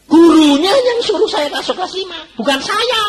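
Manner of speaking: loud, high-pitched protest; indignant retort. Retold or imitated. Pupil protesting to the director about the class placement, indignant. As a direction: push back loudly, voice leaping high.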